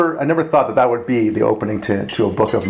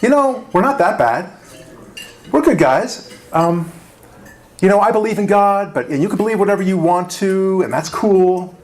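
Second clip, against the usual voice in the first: higher, mock-timid excuse-making voice; lightly mocking apologetic tone; meek apologetic conservative; 6 semitones higher than usual. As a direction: imitate a sheepish believer apologizing. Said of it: "we spend most of our time saying".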